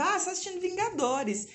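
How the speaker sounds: 1 mock excited. Mother prompting child, playful.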